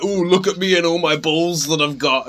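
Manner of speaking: mildly hammed-up sarcastic imitation; mocking mimicry. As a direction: mimic a show-off to tease them. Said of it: "it was obvious she was".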